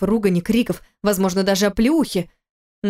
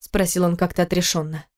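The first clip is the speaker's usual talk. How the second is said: plain reading voice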